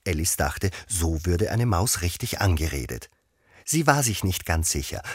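ordinary delivery

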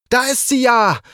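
high-pitched cry of discovery; calling out. A narrator's reading. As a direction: call out high on spotting her. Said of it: "rief Ritter Timo".

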